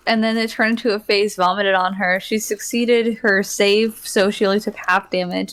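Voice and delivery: ordinary delivery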